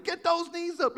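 high-pitched mock trainer's yell; Annie yelling. Instructor yelling at you at the Y M C A, mock yell. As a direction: holler it like a hammed-up fitness instructor. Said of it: "having Annie yell at you".

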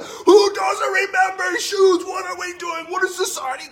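high, loud exasperated shout at the sky; shouting up to the sky, fed up; yelling at the heavens. Retold or imitated. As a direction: rail at the heavens in disbelief. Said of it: "I was yelling at the heavens".

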